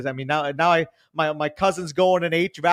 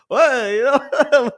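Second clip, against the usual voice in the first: higher, louder mock Italian grandpa accent; 10 semitones higher, 6 dB louder, much slower than usual. Grandson shows how he talks to nonno in Italian accent. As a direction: exclaim it like talking to nonno on the phone. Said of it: "I talk to him in an Italian accent".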